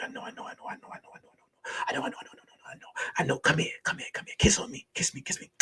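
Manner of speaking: rapid, whispered reassurance; acted intimate coaxing under the breath; hushed soothing. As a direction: shush and soothe her very softly.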